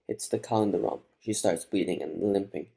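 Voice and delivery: ordinary delivery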